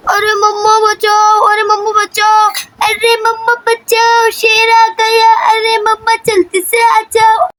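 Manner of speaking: high, sing-song mock cry for help; children calling for mother. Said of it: "मम्मा को आवाज़ देने लग जाती हैं". (मदद के लिए बच्चों की लंबी, ऊँची पुकार)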